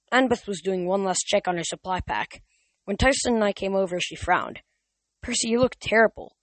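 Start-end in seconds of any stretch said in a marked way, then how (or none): 5.2-6.4 concerned surprise. A narrator's reading. Annabeth's concern.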